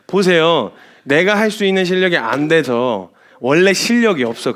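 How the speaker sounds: ordinary delivery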